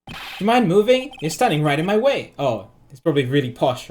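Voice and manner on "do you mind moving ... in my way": brisk, lightly voiced passer-by; stranger calling out. Stranger asking someone to move out of the way, brisk. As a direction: ask them briskly to step aside. First reading of the line, before accent try.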